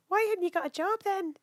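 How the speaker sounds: high, lightly voiced Glaswegian old woman; a hint of a stern Glasgow lady. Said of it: "I just heard the woman go".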